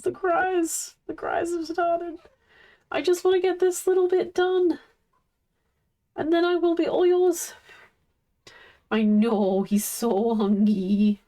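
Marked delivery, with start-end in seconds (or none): 0.0-7.5 higher sing-song pet voice. Real, not acted. Streamer promising her crying dog, in high baby talk.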